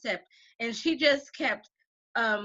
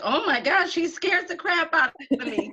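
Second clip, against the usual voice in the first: higher-pitched imitation of scared students; mock alarm; 6 semitones higher than usual. Teacher imitating students who find her scary, mock alarm. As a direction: gush it in playful, exaggerated dread.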